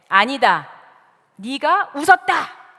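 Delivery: loud, slow, emphatic verdict; stern contradiction. Acted. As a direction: overrule the denial with acted divine authority. God contradicts Sarah's denial. (엄하고 단호하게 큰 소리로)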